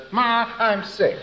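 put-on schoolboy sick call; boy feigning sickness. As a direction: play a kid slowly pleading sick. Boy feigns illness to skip school.

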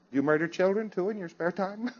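soft, amused, half-laughing jab; laughing mock accusation. Lecturer teasing students about sloppy work, laughing softly. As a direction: tease them with a chuckle.

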